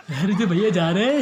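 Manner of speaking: normal talking voice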